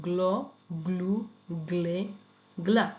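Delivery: গ্ল, গ্লু, গ্লে, গ্লা said with a hard g then l; Italian gl before a, o, u; hard gl sound. Shown as a demonstration. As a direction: say গ্লা with g and l both clear. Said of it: "এটা কিন্তু হবে গ্ল".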